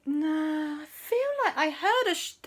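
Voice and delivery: high put-on musing voice; puzzled musing. Andy recalling a familiar noise, high puzzled voice.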